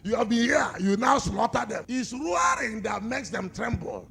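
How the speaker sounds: roaring pulpit shout; full-force preaching. Acted. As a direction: cry it out to the congregation.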